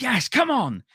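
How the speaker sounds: high-pitched celebratory yell; excited cheer. Retold or imitated. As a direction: cheer it like a fan getting great news. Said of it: "so I was like".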